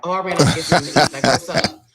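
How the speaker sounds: fast, loud, breezy husband acting innocent; husband's casual excuse. Husband answering his wife's call, playing it cool and fast. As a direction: rattle it off like nothing's wrong. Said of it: "My husband, like".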